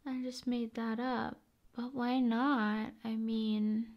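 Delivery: ordinary delivery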